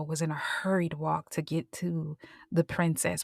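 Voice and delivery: ordinary delivery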